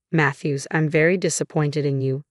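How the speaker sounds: plain reading voice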